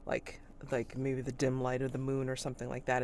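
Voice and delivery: usual speaking voice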